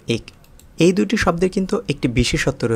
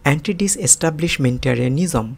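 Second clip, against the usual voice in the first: Anti ডিসএস্টাবলিশমেন্টারিয়ানিজম run through fast in one go; said quickly. Shown as a demonstration. Teacher showing the fast version of anti ডিসএস্টাবলিশমেন্টারিয়ানিজম. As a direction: rattle off anti ডিসএস্টাবলিশমেন্টারিয়ানিজম at speed. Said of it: "আমি আবার উচ্চারণ করছি".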